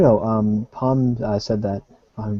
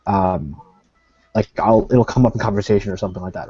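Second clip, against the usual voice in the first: usual speaking voice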